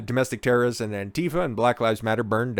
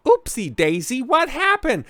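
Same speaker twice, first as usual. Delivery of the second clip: much higher, sing-song oopsie voice; mock pollster; 13 semitones higher than usual. Mocking pollsters who missed Trump, much higher and sing-song. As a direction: play a clueless pollster caught out.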